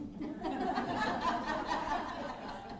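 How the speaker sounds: high, airy burst of laughter; real laugh. Real, not acted. Speaker laughs at his own joke to the panel, real laugh.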